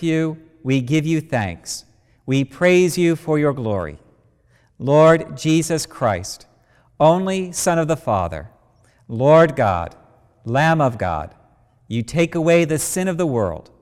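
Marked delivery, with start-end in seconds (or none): none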